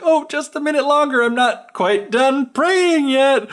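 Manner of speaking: high, sweetly drawn-out mock plea; hammed-up high stalling, slowing at the end; stalling wife. Retold wife stalling her husband.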